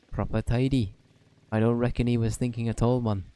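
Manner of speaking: put-on lightly Welsh-accented fairy sidekick, chatty; Welsh Drippy voice. Drippy chats to Oliver in a mild Welsh accent.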